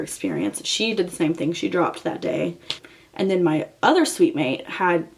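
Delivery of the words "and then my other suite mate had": ordinary delivery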